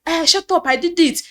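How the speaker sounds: retold harsh command; angry pastor. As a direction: silence her with force. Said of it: "he said".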